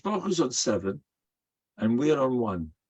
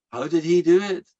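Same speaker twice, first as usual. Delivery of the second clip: ordinary talk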